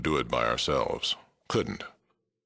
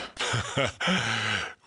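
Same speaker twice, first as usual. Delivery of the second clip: fond laugh; 8 semitones higher than usual. Real, not acted. Fond memory of dead friend.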